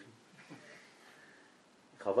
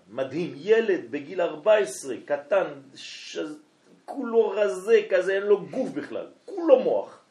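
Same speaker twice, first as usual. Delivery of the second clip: amazed description; 7 semitones higher, 6 dB louder than usual. Real, not acted. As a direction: paint him with awe, voice climbing. Marvelling at the skinny genius.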